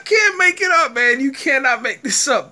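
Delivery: high, loud laughing disbelief; almost crying with laughter. Real, not acted.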